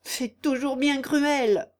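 high, drawn-out complaint; mild howl. Acted. Shrewish aunt lamenting her niece's desertion, wailing. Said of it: "hurla la mégère".

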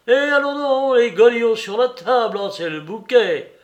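high, loud mocking cry; shouted outrage. Larrier cries out at the shoes on the table. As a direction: yell it out in mock scandal. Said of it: "Il cria".

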